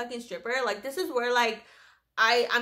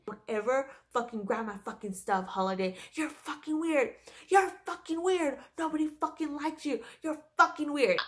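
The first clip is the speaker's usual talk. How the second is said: mock scream at no real volume, rising in pitch; retold screaming; 3 semitones higher than usual. Coworker tells Holiday off over her stuff, held-back mock screaming. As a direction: act out her screamed insults without full force. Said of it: "she's, like, screaming".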